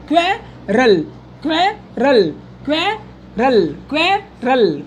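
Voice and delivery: क्वेरल broken into "kve... ral"; syllable-by-syllable reading. Shown as a demonstration. Teacher has students try reading it, syllable by syllable. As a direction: say क्वेरल in two separate beats, "KVE", pause, "ral". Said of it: "यहाँ पढ़ने का प्रयास करें".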